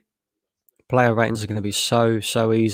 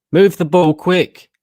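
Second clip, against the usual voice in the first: frustrated shout; 7 semitones higher, 7 dB louder, slower than usual. Real, not acted. Frustrated fan yelling at team.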